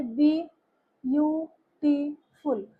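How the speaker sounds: slow "बी... यू... टी... फुल" with gaps; teacher sounding out "बी यू टी फुल" slowly; syllable by syllable. Shown as a demonstration. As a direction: break "बी यू टी फुल" into separate beats. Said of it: "तो ये हो गए syllables".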